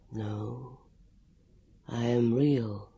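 low, slow, muffled ghost voice. A narrator's reading. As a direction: speak as if through cloth, slowly. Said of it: "in its strange, muffled voice".

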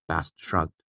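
ordinary delivery, nothing marked

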